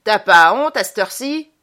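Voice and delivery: put-on loud, scolding the drunk; a madam barking at a drunk client; scolding shout. A narrator's reading. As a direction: tell him off loudly. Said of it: "et lui dit".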